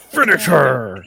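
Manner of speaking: mock announcer. A friend urging her to finish Rachel, drawn out game announcer. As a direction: growl it like a fighting game.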